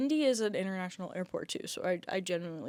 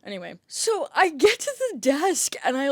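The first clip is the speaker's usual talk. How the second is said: ordinary talk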